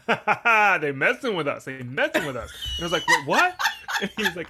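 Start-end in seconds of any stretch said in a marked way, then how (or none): 0.0-2.5 laughing. Retold or imitated. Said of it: "my uncle was like". 3.1-3.6 surprised. Retold or imitated. Confused kid reacting.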